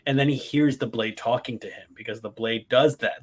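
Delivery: ordinary delivery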